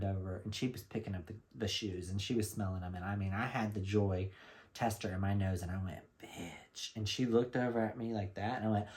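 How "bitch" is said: a soft unvoiced whisper of approval. Whispering at the perfume tester with a stranger beside her. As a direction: breathe it so the girl nearby won't hear.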